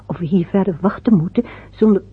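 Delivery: ordinary talk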